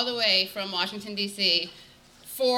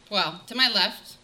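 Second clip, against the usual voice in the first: usual speaking voice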